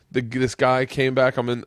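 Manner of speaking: normal talking voice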